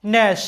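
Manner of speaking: ন্যাশ said as one syllable, silent g; ন্যাশ, the g stays silent; English word gnash. Shown as a demonstration. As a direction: say ন্যাশ like "nash", no g sound. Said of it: "এই শব্দটা একবার উচ্চারণ করা হয়".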